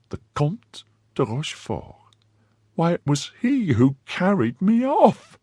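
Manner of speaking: remembering her abductor, aghast; horrified wife. Acted.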